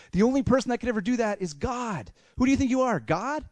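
rising mock outrage; acted indignation. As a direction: challenge him as if outraged. Acted indignant religious leaders.

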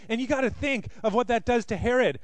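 ordinary talk

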